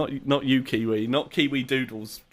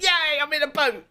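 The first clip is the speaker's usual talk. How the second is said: squeaky Blipsy voice; 13 semitones higher than usual. Retold or imitated. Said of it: "Blipsy's like".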